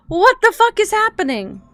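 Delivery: high, loud burst of disbelief; exasperated shout. Real, not acted. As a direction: yell it in baffled frustration. Streamer baffled by glitching game.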